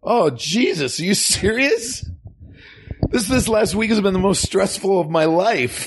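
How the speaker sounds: put-on high, whining kid outburst; exasperated boy John. John fed up with mom's warnings, exasperated kid. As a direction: vent like a stressed-out child. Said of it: "you can be John".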